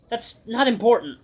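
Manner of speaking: high, strained dodge; defensive brush-off. Acted. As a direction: wave the question away in a high voice. Hero brushes off friend's question.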